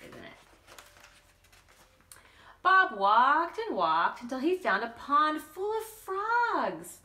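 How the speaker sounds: ordinary delivery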